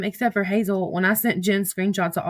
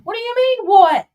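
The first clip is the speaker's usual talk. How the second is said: high-pitched, louder comeback; incredulous retort; 13 semitones higher, 8 dB louder than usual. Real, not acted. Speaker answering someone nearby, incredulous. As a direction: throw it back high, ending loud. Calls back to someone off mic.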